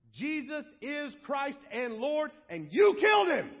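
slow, high declaration building to a shouted accusation; thundering accusation at the crowd; Peter. Acted. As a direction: preach it forcefully, then shout the charge. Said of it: "Peter's over here".

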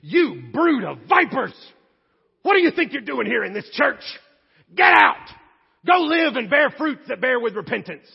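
loud rebuke rising to a shouted Get out; mock hellfire preacher. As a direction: hammer the crowd like a furious preacher. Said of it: "imagine if ... I stood up to preach".

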